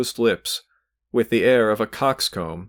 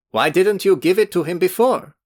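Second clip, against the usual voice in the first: higher, louder and severe; 9 semitones higher, 4 dB louder than usual. A narrator's reading. The youth severely questions Velchaninov, demanding. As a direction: demand it sternly. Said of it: "addressing Velchaninov severely".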